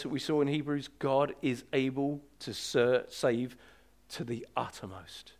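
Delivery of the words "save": ordinary talk, nothing marked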